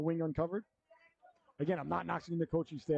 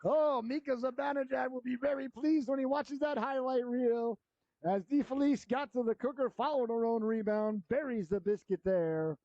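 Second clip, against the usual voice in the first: higher, thrilled commentary after the goal; excited announcer; 8 semitones higher than usual. Real, not acted. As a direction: ride the high of the goal.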